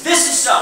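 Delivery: high, stated as settled fact; emphatic statement. Shown as a demonstration. Presenter demoing an emphatic statement, high and firm. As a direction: lay it down as the last word. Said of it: "Makes emphatic statements".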